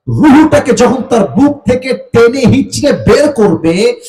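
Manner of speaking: high, driving sermon delivery; fiery warning to the congregation; full-force preaching. Real, not acted.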